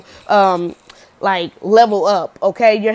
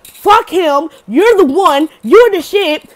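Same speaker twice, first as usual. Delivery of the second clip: much higher and louder, all-in cheer; fired-up hype; 7 semitones higher, 8 dB louder, much slower than usual. Real, not acted. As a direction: shout encouragement at the viewer. Own hype to viewer.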